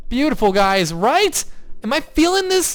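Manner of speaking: high, giddy questions to the viewers; excited hype. Real, not acted. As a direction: pitch shoots up with real excitement. Hyped over the new house.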